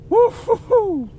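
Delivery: high, slow sing-song woo-hoo; giddy whoop. Real, not acted. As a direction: crow with delight. Celebrating the fish.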